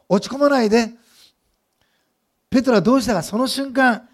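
impassioned sermon delivery; full-force preaching. Acted. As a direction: preach it out to the congregation with fire.

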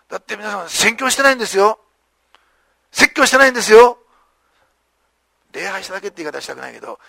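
normal talking voice

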